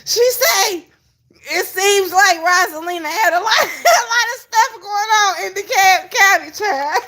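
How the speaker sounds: high-pitched, helpless laughter through the words; real laughing fit. Real, not acted. Retelling what she said about Rosalina, helplessly laughing. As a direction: squeal it out while cracking up. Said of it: "Child, I can't breathe".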